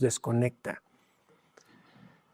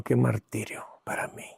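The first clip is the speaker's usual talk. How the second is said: breathy whisper of torment; 4 semitones lower, 9 dB quieter than usual. Acted. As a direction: whisper it like a painful secret. Preacher recalls his torment.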